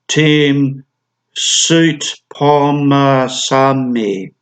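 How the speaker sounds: SOUTPHOMMASANE drawn out syllable by syllable; very slow reading. Shown as a demonstration. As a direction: spell out Soutphommasane slowly by sound, stretching each part. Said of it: "have to read this name slowly".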